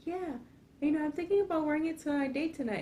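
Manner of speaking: mock-sweet girlfriend, very high pitched; movie girlfriend. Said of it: "And she's like".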